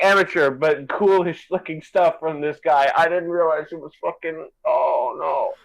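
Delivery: ordinary delivery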